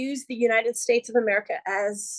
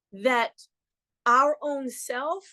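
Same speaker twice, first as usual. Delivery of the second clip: ordinary delivery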